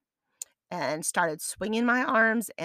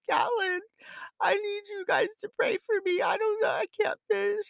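high, strained, near-tears plea for prayer; desperate high plea; 12 semitones higher than usual. Retold or imitated. Mother on the phone begging her son to pray, high and desperate. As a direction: beg your son to pray, voice cracking high. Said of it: "and I'm like".

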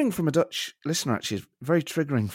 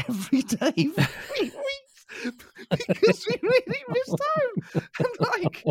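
laughing while talking; slower than usual. Real, not acted. Laughing while telling sad story.

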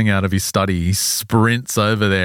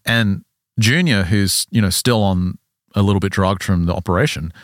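ordinary talk, nothing marked

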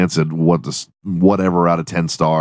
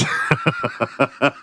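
high breathy giggling fit; can't stop laughing at the word; real helpless giggle; 7 semitones higher, 5 dB quieter than usual. Real, not acted. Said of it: "I'm already laugh".

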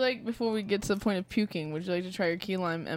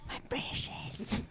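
slow, whispered creepy hiss; Gollum voice; 7 dB quieter, much slower than usual. Retold or imitated. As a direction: whisper it like a creature guarding treasure. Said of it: "She looks like Gollum".

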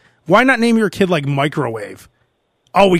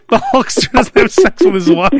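laughing through the punchline; 5 semitones higher, 4 dB louder than usual. Real, not acted. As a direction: giggle out the joke, a bit higher. Laughing at own joke.

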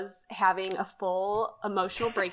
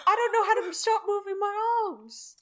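panicked character voice; 12 semitones higher than usual. Retold or imitated. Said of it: "He's like".

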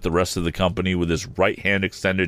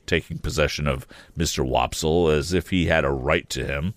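plain reading voice

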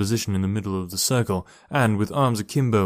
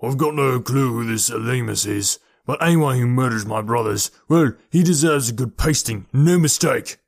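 mildly angry, building to a louder finish; angry growl; 4 dB louder than usual. A narrator's reading. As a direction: growl a threat, rising at the end. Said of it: "Horse growled angrily".

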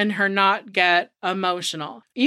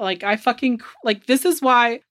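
ordinary delivery

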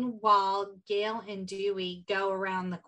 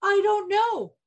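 higher, louder, drawn-out comeback; hammed-up movie quote with a laugh; acted comeback; 4 semitones higher, 6 dB louder, slower than usual. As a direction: throw the insult back theatrically. Acting out Dewey's comeback.

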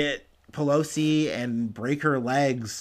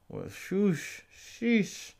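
soft, higher drawn sheesh; 7 semitones higher, 6 dB quieter, much slower than usual. Real, not acted. Vlogger reacting to the hairline, quiet high sheesh. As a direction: exhale a quiet disbelieving sheesh.

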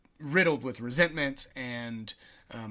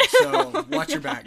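laughing, slow and higher; higher, louder, slow laughing warning; mock warning, laughing; 13 semitones higher, 7 dB louder, slower than usual. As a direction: tease with a fake threat. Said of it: "joking warning".

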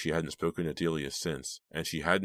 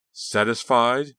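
loud, high, drawn-out challenge; angry snap; 7 semitones higher, 8 dB louder, slower than usual. A narrator's reading. Sarah to Delia, snapping angrily. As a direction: throw it at her, loud and rising. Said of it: "Sarah snapped at Delia".

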